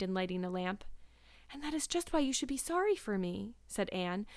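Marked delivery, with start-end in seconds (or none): 1.5-3.6 high, pitiful insistence; plaintive. A narrator's reading. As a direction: press for sympathy in a girlish voice. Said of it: "a plaintive voice".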